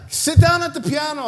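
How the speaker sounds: sharp commanding mom shout; mock shout. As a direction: bark the order like a stern mom. Mom commands son at piano.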